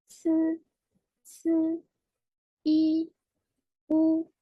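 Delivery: each syllable held high and level; chant-like model reading on a steady high pitch; pronunciation drill. Shown as a demonstration. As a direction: recite Mandarin initials one by one, evenly sustained. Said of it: "On va lire ensemble".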